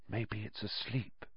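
soft, breathy near-whisper; breathed. A narrator's reading. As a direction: barely breathe the words. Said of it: "he breathed".

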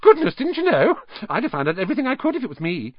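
very high start, then rapid; exclaim, then hurry on bossily; astonished know-it-all girl. A narrator's reading. Hermione to Harry, who never knew, astonished and brisk.

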